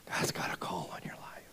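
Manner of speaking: soft, low half-whisper; quiet and low, mock-mysterious; hushed pronouncement. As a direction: imitate a friend's eerie hushed prophecy. Friend's crazy-eyed pronouncement.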